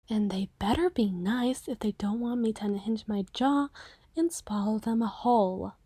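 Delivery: soft, unvoiced mock menace; a playful creepy warning under the breath; whispered threat.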